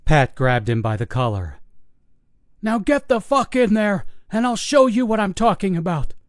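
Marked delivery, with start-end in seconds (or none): 2.6-6.1 put-on high, desperate old man shouting; frantic old guard. Old guard pleading with investigators who won't listen, desperate. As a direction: plead hard, near panic, pitched high. Pat grabs collar.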